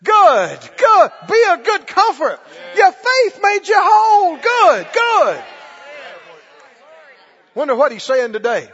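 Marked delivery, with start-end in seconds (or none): 0.0-5.4 loud, rising cries of "good"; joyful blessing. Retold or imitated. Preacher voicing Jesus blessing the healed woman, loud and joyful. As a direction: proclaim it like an overjoyed father. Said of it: "He said".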